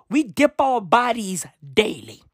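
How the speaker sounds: usual speaking voice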